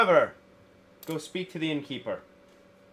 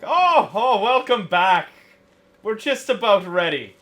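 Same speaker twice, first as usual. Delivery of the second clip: much higher and louder, a cheery patient welcome; genial captain; 9 semitones higher, 11 dB louder, slower than usual. Acted. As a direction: greet them warmly like a mild-tempered captain. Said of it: "he's like".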